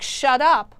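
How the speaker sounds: a retold threat thrown out as a brief snap; a hint of a menacing doctor barking; mock snapped order. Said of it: "He's like".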